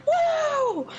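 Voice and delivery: high-pitched imitated crowd whoop; acted cheer from the audience. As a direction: give a high woo as the crowd. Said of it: "everyone's like".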